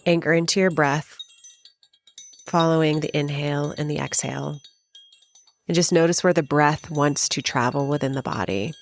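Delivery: spacious, even meditation voice with long pauses; guided meditation. Acted. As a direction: guide the listener gently inward, phrase by phrase.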